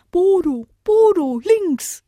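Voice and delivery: high, loud warning call. Acted. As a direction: shout the warning from the tree. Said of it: "rief die Eule vom Baum".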